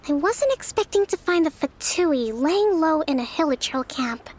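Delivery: ordinary talk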